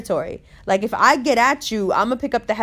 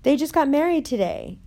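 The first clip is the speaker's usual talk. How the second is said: put-on higher pleading voice of the brown girl; placating girl; 4 semitones higher than usual. Girl at the wedding urging Umber to smile, higher coaxing. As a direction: coax her to smile and drop it, pitched up. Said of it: "and goes".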